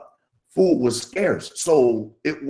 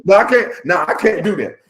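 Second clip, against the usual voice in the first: emphatic protest; 8 semitones higher, 7 dB louder than usual. Real, not acted.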